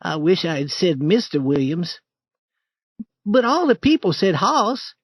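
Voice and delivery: put-on high boyish voice, uneasy; a scared boy asking in the dark; young Tom. Tom asking Huck at the new grave, high nervous boy.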